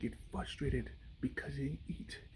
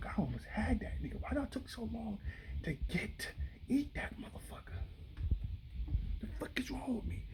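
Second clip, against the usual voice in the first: higher, hushed grumbling to itself over the miss; alligator talking to itself; 6 semitones higher, 3 dB quieter than usual. Acted. Frustrated alligator muttering to itself after missing the zebra. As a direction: voice the alligator cursing its own failure. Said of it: "has to talk to itself".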